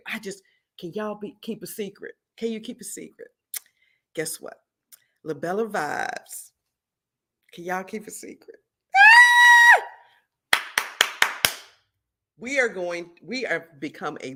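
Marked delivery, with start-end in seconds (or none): 0.1-8.6 hushed secret. Real, not acted. Teasing a secret to viewers. 9.0-10.6 high, loud squeal of joy; excited scream. Real, not acted. Revealing the secret.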